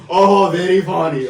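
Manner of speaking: drawn-out mock teacher in a thick Mexican accent; stupid little accent. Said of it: "with a stupid little accent".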